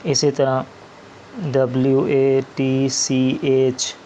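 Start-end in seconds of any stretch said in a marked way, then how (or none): 1.4-4.0 slow spelling. Shown as a demonstration. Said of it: "spelling out letters".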